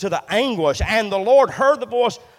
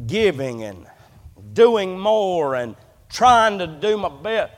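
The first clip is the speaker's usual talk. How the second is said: ordinary delivery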